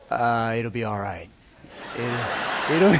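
low, mumbled brush-off; lightly voiced sleepy husband dodging the chore; lazy excuse. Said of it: "what guys say when we don't want".

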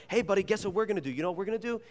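put-on high, quick talk to a toddler; excited dad. Father teasing a surprise to his small son, excited. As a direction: build up the surprise eagerly.

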